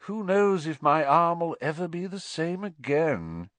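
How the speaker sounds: high-pitched, put-on woe; fake suffering. A narrator's reading. Malfoy dramatizing his injured arm, fake suffering. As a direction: lament it with false pathos. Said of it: "He gave a huge fake sigh".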